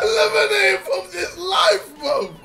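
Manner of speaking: high, loud anime mimicry through laughter; overacted anime dub. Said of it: "talking like an anime character".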